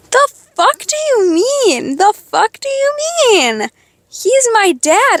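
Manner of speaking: high, slow, incredulous the fuck do you mean; indignant at the stepmom's words, voice climbing high; outraged disbelief. Retold or imitated. Outraged retelling of stepmom quarrel.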